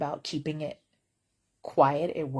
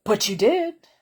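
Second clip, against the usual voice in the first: louder put-on voice of the customer; mock caller voice; 4 semitones higher, 9 dB louder than usual. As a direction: snap back as the caller. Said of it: "I was like".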